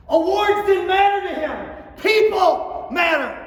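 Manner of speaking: loud, high, fervent declaration; rising to an angry roar; impassioned. Real, not acted. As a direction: hammer home that people matter. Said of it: "that was kinda angry, wasn't it".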